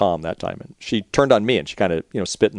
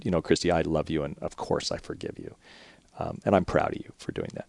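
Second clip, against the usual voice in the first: much softer, lower and tender; almost hushed, loving reassurance; father forgiving his teenage daughter; tender forgiveness; 5 semitones lower, 11 dB quieter than usual. Retold or imitated.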